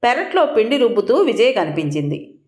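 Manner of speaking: usual speaking voice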